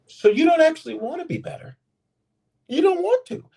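very high, incredulous challenge; impassioned preacher's high-pitched retort; fervent preaching. Real, not acted. Preaches the point.

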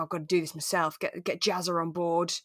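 ordinary talk, nothing marked

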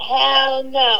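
lightly voiced drawn refusal; a hint of his funny way of talking; imitating Fez. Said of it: "the way this man talks is so funny".

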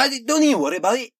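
high, quick mock Scottish accent; joking Scottish brogue. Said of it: "It's a Scottish bear".

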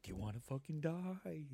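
echoing doctor voice. Retold or imitated. Doctor's words spiraling in a stoned head, soft imitated echo. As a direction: replay it softly, like a looping memory. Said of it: "Like, spinning around".

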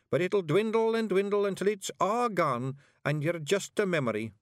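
high, small Scottish voice, emphatic; grave Scots bard. A narrator's reading. Little bard warns witch she would dwindle, grave. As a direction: warn earnestly in piping Scots.

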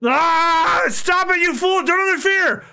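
put-on an old chef shouting to stop the reckless kid; yelling. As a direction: yell at the boy to stay out.

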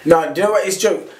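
usual speaking voice

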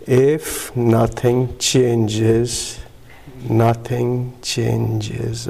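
word by word, with long drawn-out vowels; very slow reading. Retold or imitated. Newcomer told to read the chip again, extremely slowly. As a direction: spell out each word slowly, pausing midway. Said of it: "Read it slowly".

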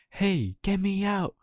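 high, slow puppet cry to be let out; a small wooden boy pleading from a cage; trapped puppet calling out. Acted. Pinocchio calling from the locked birdcage, high and slow. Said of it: "said Pinocchio".

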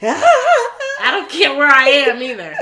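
loud, laughing protest; emphatic outburst. Real, not acted. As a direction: blurt it out loud through a laugh. Joking outburst about fleeing bees.

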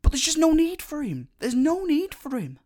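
pitched high, dropping at the end; exasperated protest. Real, not acted. Speaker telling co-host the character is pointless, exasperated. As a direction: insist in a high, exasperated wail.

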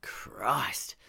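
shocked whispered curse. Acted. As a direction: breathe it out in disbelief. Officer sees hog-tied woman.

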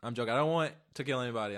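ordinary talk

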